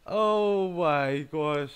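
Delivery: loud, high, slow exclamation; incredulous. Real, not acted. Player who can't find anyone else, incredulous. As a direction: draw it out loudly in disbelief. Exclaiming after laughing at bad luck.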